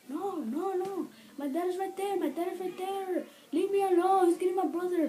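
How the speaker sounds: put-on high, frantic plea from a scared girl; panicked girl. Rachel begging strangers to leave her alone, panicked.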